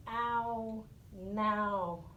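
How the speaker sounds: ordinary delivery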